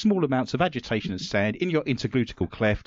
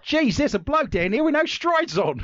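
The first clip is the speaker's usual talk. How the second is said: mock Australian accent; 13 semitones higher than usual. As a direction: play a brash Aussie bloke. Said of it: "how do you do Australia".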